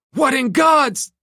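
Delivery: very high, loud, cut-off cry; horrified shout. A narrator's reading. Husband crying out in horror at the gunman. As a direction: shout in shock.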